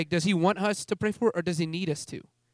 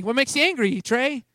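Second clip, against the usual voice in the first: higher, teasing needling; playful poking; 8 semitones higher than usual. Retold or imitated. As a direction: lightly goad with question after question. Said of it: "God started poking me".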